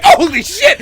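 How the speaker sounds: loud, high startled yelp; laughing mock shock. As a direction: jump out of your skin. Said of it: "I was like".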